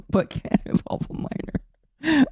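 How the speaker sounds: laughing. Real, not acted. Laughing at own correction.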